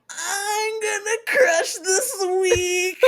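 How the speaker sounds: high, slow, laughing declaration; hyped up. Retold or imitated. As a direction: drag it out like a battle cry. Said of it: "I was like".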